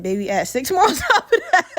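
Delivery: high-pitched comeback breaking into laughter; amused retort. Retold or imitated. Woman correcting a guess at her age, amused high retort. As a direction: crack up as you correct them.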